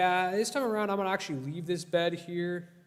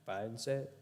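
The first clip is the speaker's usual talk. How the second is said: smug, soft; 6 semitones lower, 10 dB quieter, faster than usual. Real, not acted. Teasing chat about trick.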